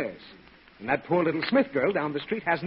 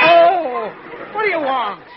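high, loud pained whine; jolted, answer in irritated agony; startled exasperation; 8 semitones higher, 8 dB louder, slower than usual. Acted. Startled hungover father.

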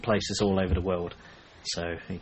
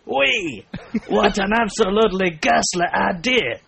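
hammed-up French, high and loud; French accent; 9 semitones higher, 4 dB louder than usual. Reading a French woman's comment aloud, over-the-top French accent. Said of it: "how to do a French accent".

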